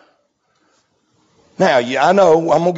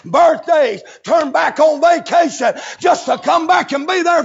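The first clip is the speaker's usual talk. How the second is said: preaching at full force; 7 semitones higher than usual. Acted. Preacher shouting the story to the church. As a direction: whoop it out to the congregation.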